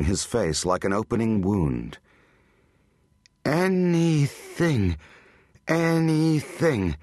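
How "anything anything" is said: high, drawn-out automatic repetition; stretched, raised notes, mechanically repeated. A narrator's reading. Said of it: "like she's on automatic".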